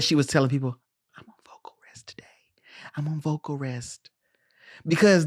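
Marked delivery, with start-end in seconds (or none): 1.2-2.2 barely-there whisper. Acted. Imitates actress resting voice. 3.0-4.1 soft voice, saving the throat; hushed. Acted. Imitates actress resting voice.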